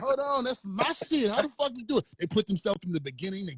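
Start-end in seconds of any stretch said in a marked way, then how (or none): 0.0-2.0 mock indignant protest. Host voicing artist robbed of his song, mock indignant. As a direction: protest in a raised, stretched-out voice. Imagined protest at scene-stealing feature.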